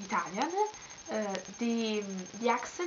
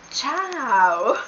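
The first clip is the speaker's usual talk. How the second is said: loud, drawn-out ciao; big swooping Italian hello; exuberant greeting; 10 dB louder than usual. Shown as a demonstration. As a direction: call it out playfully. Shows an Italian greeting playfully.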